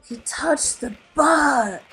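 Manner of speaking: slow, awed little fish voice; amazed kid. A narrator's reading. Classmate watching Nemo touch the boat, slow awe. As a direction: breathe it out in wonder. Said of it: "Tad said in amazement".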